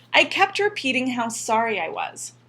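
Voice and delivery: usual speaking voice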